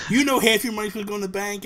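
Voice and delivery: mock exasperated parent; frustrated dad. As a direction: imitate a dad reminding a kid of the rule. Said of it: "So he got all frustrated".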